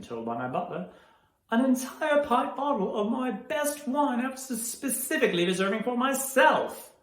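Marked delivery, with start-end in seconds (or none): 1.5-6.9 high-pitched, affronted outburst; indignant outrage. Acted. Householder complaining of his wine being drunk, indignant. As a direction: climb high with offended disbelief.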